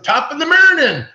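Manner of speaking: high, loud mock Irish greeting; a hammed-up Irish "top of the morning"; Irish accent. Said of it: "I've used an Irish accent".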